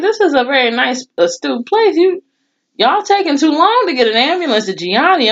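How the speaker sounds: high, outraged scolding; indignant complaint. Retold or imitated. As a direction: scold them for dawdling in a nice area. Imagines scolding slow responders.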